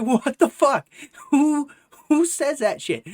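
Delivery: high, loud disbelief; appalled, hammed-up exclamation; incredulous outburst. Replaying his shocked reaction.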